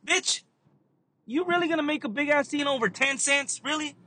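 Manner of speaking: high-pitched disbelieving retort; mock incredulous. Cashier imagines telling off customer over ten cents, mock incredulous. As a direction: act appalled at a petty customer.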